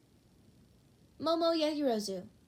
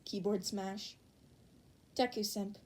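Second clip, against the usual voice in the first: usual speaking voice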